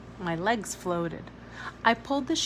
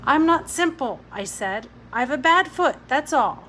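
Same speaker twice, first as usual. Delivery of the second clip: higher, louder, defensive retort; indignant girl; 5 semitones higher, 7 dB louder than usual. A narrator's reading. Ada to Billy's mother, loud and indignant. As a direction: snap back in defence.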